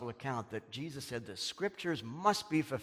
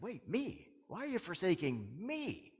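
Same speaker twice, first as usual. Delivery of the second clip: me lifted higher each time; stress on me; 5 semitones higher, 3 dB quieter than usual. Shown as a demonstration. Preacher showing the Hebrew, stress on me. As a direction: lean hard on me. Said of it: "the emphasis is on the word me".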